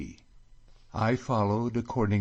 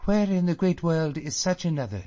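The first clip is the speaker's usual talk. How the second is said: high, loud rapturous exclamation; rapturous cry; 8 semitones higher, 5 dB louder than usual. A narrator's reading. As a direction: cry it out in love for home. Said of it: "she cries".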